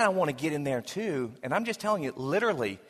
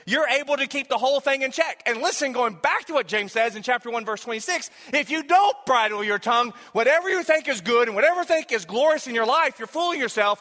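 raised, pressing sermon delivery; full-force preaching; 7 semitones higher, 3 dB louder than usual. Real, not acted. Preacher warning those who don't bridle their tongue, forcefully. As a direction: warn the congregation with rising urgency.

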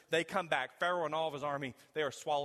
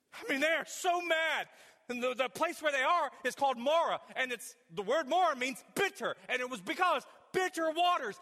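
full-force preaching; 12 semitones higher, 6 dB louder than usual. Real, not acted.

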